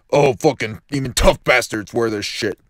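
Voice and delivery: ordinary delivery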